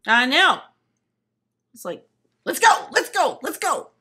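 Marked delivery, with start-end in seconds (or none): 2.4-3.9 high, loud imitation of a pushy coach; mock drill sergeant. Imitating her co-host driving her on, as a mock taskmaster. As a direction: crack the whip, hurry them along. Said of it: "You cracking the whip".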